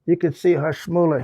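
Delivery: ordinary talk, nothing marked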